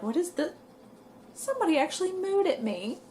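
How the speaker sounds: high, amazed retelling of disbelief; surprised disbelief. Retold or imitated. Reading a surprising moo message.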